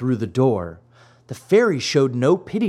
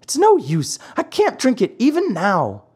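high-pitched puppet whine; whiny, helpless Pinocchio refusal; childish protest; 10 semitones higher than usual. A narrator's reading. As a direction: give up fussily, pitched way up. Pinocchio refusing once more.

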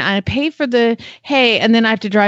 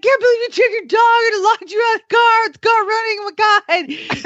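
much higher teasing impression of the husband; mocking husband; 11 semitones higher than usual. Husband mocks her for being locked out, high teasing voice. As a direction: tease her as he laughs at her. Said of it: "but he's like".

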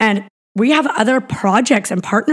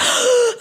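panicked gasp. Retold or imitated. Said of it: "I'm like".

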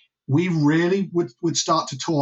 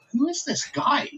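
retold surprise; 10 semitones higher, 4 dB quieter than usual. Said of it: "and I was like".

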